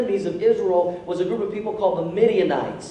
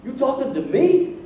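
higher, disbelieving 'me?'; raised-pitch astonished comeback; incredulous question; 5 semitones higher than usual. Retold or imitated. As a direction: ask it in rising disbelief. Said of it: "that's how I hear it".

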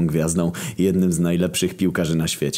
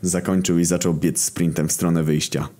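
normal talking voice

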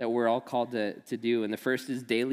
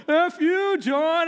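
much higher and louder yelled F you; mock shout; 7 dB louder, slower than usual. Jamie yelling her joke in the church halls, loud mock shout. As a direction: yell it across the church hall. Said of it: "he just hears Jamie go".

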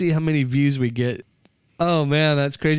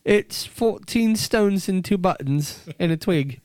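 slow, measured mock-British answer; British accent; 3 semitones higher, slower than usual. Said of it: "there's other British accents".

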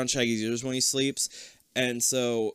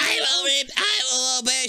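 high scratchy mock chant; 12 semitones higher, 4 dB louder than usual. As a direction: imitate a scratchy-voiced brainwashed girl. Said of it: "She has a very scratchy voice".

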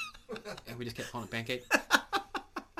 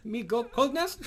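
higher broken-English crewman; 9 semitones higher, 4 dB louder, slower than usual. Acted.

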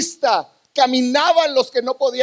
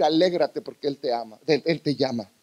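tender; 10 semitones lower, 6 dB quieter than usual. Real, not acted. Pastor comforts the congregation.

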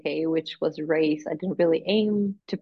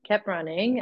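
ordinary delivery

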